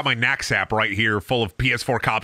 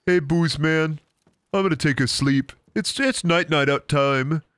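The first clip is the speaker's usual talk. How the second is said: goofy character voice; 3 semitones higher than usual. Pretend. Playful voice for game buddy.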